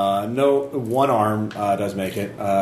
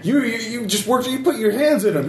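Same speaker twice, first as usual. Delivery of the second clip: much higher, frantic accusing voice; panicked soldier; 12 semitones higher than usual. Acted. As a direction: shout in panic.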